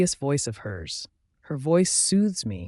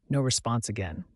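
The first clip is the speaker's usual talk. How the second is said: ordinary delivery, nothing marked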